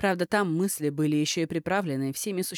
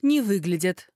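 forced stern protest, slightly louder; trying to sound angry; 4 dB louder than usual. Acted. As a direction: put on a cross voice, not quite convincing. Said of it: "постаралась звучать как можно более сердито".

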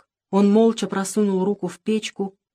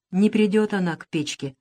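ordinary delivery

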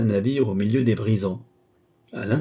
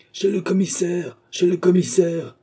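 high, insistent mob cry; relentless shouting; 9 semitones higher, 4 dB louder than usual. A narrator's reading. Crowd hounding two women, relentless high shouting. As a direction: chant it high, building louder on the repeat. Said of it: "continuaient de crier les acharnés".

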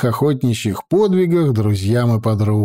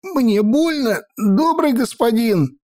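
much higher, slow pained plea; pained servant; 11 semitones higher than usual. A narrator's reading. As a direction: whimper a plea through the pain. Servant in pain from grip.

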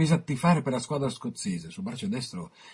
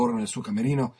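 plain reading voice

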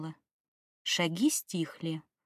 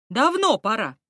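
enthusiastic; 5 dB louder than usual. A narrator's reading. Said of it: "С энтузиазмом".

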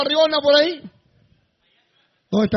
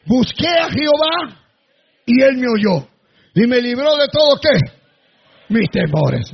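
loud, slowed scripture proclamation; proclaiming; 6 dB louder than usual. Real, not acted. Preacher reading the psalm to the fearful, proclaiming. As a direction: declaim the verse like a triumph.

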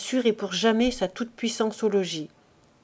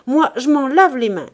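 loud, higher angry cry; shouting; 4 semitones higher, 7 dB louder than usual. A narrator's reading. Pierre blaming his wife, shouting. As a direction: wash his hands of it, yelling. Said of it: "cria-t-il".

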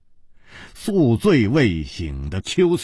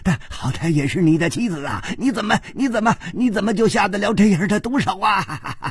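high, stammering outcry with sobbing gasps; 6 semitones higher than usual. Acted. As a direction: wail out the accusation, breath heaving. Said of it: "秋瓷王嘶叫着说". (激愤地嘶叫，气喘不止)

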